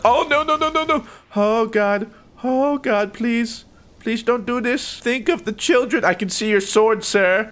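high, whimpering begging; panicked pleading. Real, not acted. Gamer begging a swordsman to stop, high panicked plea.

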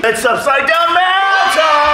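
high drawn-out shouted announcement; announcer shout. Acted. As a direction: hype it like a game show host. Announcing segment title.

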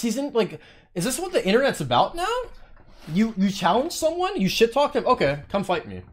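usual speaking voice

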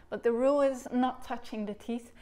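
ordinary talk, nothing marked

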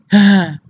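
short, loud, falling "ah" sound; imitated outburst. Showing what he can't be like, loud imitated cry. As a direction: blurt a loud mock "haah". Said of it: "He can't be like".

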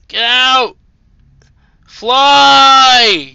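high, loud command to fly; excited shout. Real, not acted. Player leaping over an obstacle, shouting excitedly. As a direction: cheer the jump on. Jumping in game.

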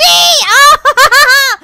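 high, loud cry breaking into laughter; shocked yell. Real, not acted. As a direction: yell out at the sudden fail. Fails after boasting.

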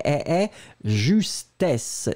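justesse slowed, ending on a hissed double S; teacher modelling justesse; slow model pronunciation. Shown as a demonstration. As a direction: stretch the final SS of justesse. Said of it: "Et le double S".

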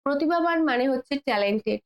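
ordinary talk, nothing marked

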